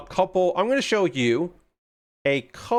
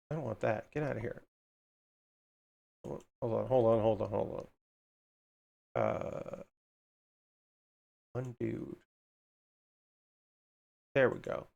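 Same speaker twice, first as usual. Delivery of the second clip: low, soft muttering under the breath; muttering to herself; 3 semitones lower, 11 dB quieter than usual. Real, not acted. Streamer fixing her OBS, muttering quietly to herself. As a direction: mumble it to yourself, half forgetting the audience.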